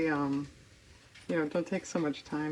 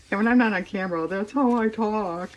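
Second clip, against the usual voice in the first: dopey voice; 5 semitones higher, 9 dB louder than usual. Pretend. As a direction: ham it up as a dopey goof. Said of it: "I'm making dumb voices".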